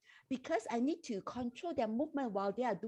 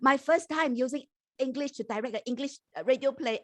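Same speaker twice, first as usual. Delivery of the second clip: louder, projected into the mic; speaking up; 3 semitones higher, 3 dB louder than usual. Real, not acted. Director repeating the answer after being told to speak louder, speaking up. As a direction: speak up so the listeners can hear. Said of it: "Could you speak a bit louder".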